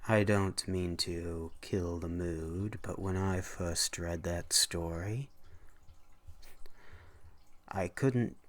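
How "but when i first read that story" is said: usual speaking voice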